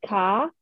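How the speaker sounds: "ka" for car, no R; Massachusetts R-drop. Shown as a demonstration. Retelling comedian's R replacement joke, "ka" with no R. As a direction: say "car" as a Boston "kah". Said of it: "in Mass we'll say things like".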